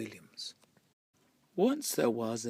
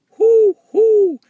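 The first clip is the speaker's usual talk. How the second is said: loud, high owl hooting; 20 dB louder than usual. A narrator's reading. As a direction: hoot it like a big owl. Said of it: "said a fat owl".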